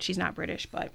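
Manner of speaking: ordinary talk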